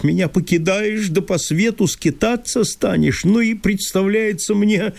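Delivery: high, agitated landowner in disbelief; Chertopkhanov voice. Acted. Landowner reproaching Masha for leaving, high and fretful.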